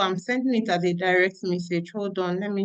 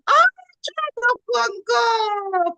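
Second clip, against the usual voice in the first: delighted surprise; 12 semitones higher, 3 dB louder, much slower than usual. Real, not acted. Excited greeting of Congolese guest.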